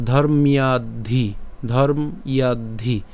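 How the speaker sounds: ধর্ম্যাদ্ধি said slowly as dhormyaddhi; slow joined demo. Shown as a demonstration. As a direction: draw out ধর্ম্যাদ্ধি with the y glide. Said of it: "একসাথে বললে ধর্ম্যাদ্ধি".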